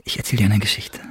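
voiceless promise of a story; whisper. Acted. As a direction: lean in and whisper it. Whispering a bedtime story offer.

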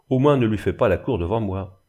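usual speaking voice

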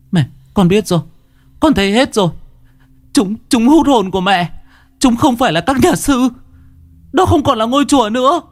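put-on high, fast frightened girl's cry; distraught girl. A narrator's reading. Daughter telling her mother what she saw, high frantic voice. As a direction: blurt out the horror, voice climbing.

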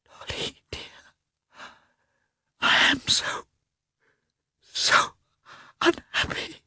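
whispered through tears; broken tearful whisper; suppressed sobbing. Acted. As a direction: sob it out, barely voiced. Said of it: "a burst of suppressed sobbing".

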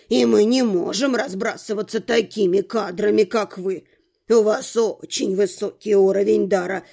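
put-on low, unyielding old man's voice; firm old rector. A narrator's reading. Rector refuses to release the heroine, calmly firm. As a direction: refuse calmly in a deep, measured register.

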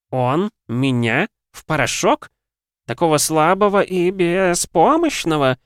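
put-on high, trembling feeble old voice; quavering old man. A narrator's reading. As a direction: play a frail, shaky old geezer in mockery. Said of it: "мастерски изобразил старческую дребезжащую речь".

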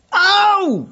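high, sudden pained yelp; sharp yelp of pain. A narrator's reading. Narrator yelps as the masseur pinches his fat. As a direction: cry out as fingers dig in. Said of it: "wrzasnąłem".

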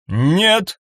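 loud, sharp angry no; angry refusal. A narrator's reading. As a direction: cut him off in anger. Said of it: "гневно отрезала Романова".

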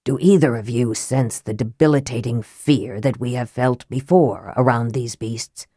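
low, measured mage questioning; lightly voiced mage in a low undertone; Raistlin. A narrator's reading.

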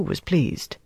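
ordinary delivery, nothing marked

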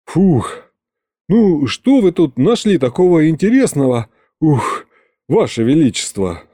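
пыхтя после подъёма; говори с передышками, отдуваясь после крутой горы; запыхавшись. A narrator's reading. Out of breath after climbing. (с одышкой, прерывисто)

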